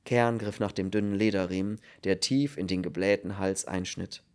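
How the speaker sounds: plain reading voice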